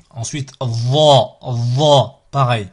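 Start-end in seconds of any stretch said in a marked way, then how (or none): 0.6-2.2 Va with a heavy, dark vowel like "Vo"; emphatic sound. Shown as a demonstration. Teacher demonstrating an emphatic letter, Va heavy and dark. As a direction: press Va out dark and backed. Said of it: "Ces quatre-là sont des sons emphatiques".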